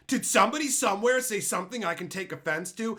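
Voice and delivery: high, loud whiny mock; hammed-up touchy voice; mocking offended person. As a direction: parody someone hunting offense. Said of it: "it's like".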